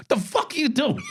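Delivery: high shouted outburst at his partner; retold yell. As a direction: yell it in disbelief, pitched high. Cop yelling at partner mid-raid.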